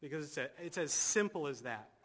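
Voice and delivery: ordinary talk, nothing marked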